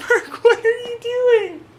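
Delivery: high, laughing coo at the pet; squeaky laughing pet voice. Real, not acted. Talking to her cat.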